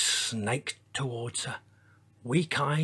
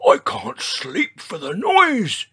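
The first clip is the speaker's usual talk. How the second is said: put-on loud, slow old grumbler, pitched up; grumbling; 7 semitones higher, 5 dB louder, slower than usual. A narrator's reading. Old Triceratops complains about the noise, grumbling. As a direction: complain noisily as a grumpy old dinosaur. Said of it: "he grumbled".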